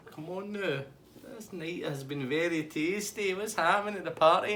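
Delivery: high-pitched coaxing party guy; Tasty Dave. Retold or imitated. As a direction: wheedle in an imitated high voice. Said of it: "He's like".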